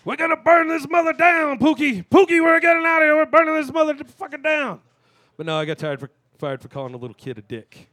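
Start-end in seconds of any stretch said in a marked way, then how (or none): 0.0-4.8 high, wild yell. Retold or imitated. Fired cashier rampaging out of the store, high wild yelling. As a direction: scream it like you're torching the place.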